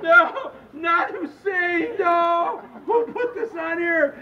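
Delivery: high, drawn-out slow-motion howl; slow-motion mock wail. Voter in the Matrix over the ballot, slow-motion wail. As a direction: stretch every word like a film's slow-motion scream. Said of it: "just in slow motion".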